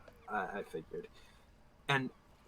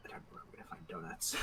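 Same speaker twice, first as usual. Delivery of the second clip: whispering; 17 dB quieter, faster than usual. Real, not acted. Aside to the other players.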